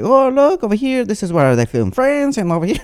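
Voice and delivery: high-pitched, hammed-up fake Haitian accent; bad Haitian accent. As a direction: play a tour guide in a clumsy Haitian accent. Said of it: "This is not a good Haitian accent".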